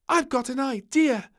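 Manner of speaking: high, loud sudden idea; bright idea. Acted. Little Princess struck by an idea, high loud call. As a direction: pop it out with a smile. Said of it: "she smiled suddenly".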